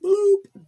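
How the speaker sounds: a high, loud cartoon bloop; pop sound. Telling viewers how TikTok showed it, a high, loud bloop. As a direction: make the pop of something appearing. A thing pops up on her feed.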